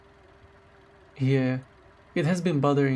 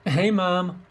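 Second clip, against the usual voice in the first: bright, higher hello to Mom; cheerful greeting; 5 semitones higher than usual. Acted. Girlfriend answering Mom's call on speaker, cheerful and higher. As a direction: greet her like you're happy she called.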